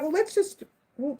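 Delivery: normal talking voice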